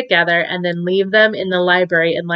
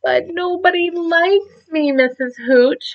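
put-on small, high, tearful child voice; a young porcupine pleading through tears; mild crying in a high little voice; 10 semitones higher than usual. A narrator's reading. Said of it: "cried little Prickles".

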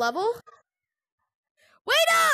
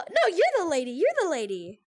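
normal talking voice

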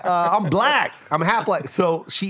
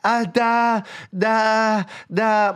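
Filipino mom stammer; 5 semitones higher, much slower than usual. Retold or imitated. Imitating Filipinos forgetting names.

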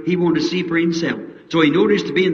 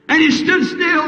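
ringing proclamation; 10 semitones higher than usual. Shown as a demonstration. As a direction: lift it to the rafters. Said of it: "preacher repeats the line".

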